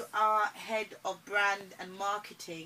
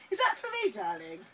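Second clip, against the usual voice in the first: ordinary delivery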